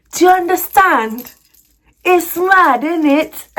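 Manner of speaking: high, drawn-out mock British indignation; exaggerated amateur UK accent, outraged; UK accent attempt. Shown as a demonstration. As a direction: ham up a London accent, slowly and loudly. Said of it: "let me do my best U K accent".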